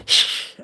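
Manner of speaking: whispered hiss like an angry cat. Retold or imitated. Imitating her cat hissing back at her, a breathy hiss. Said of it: "he turned back like".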